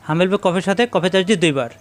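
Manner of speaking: ordinary talk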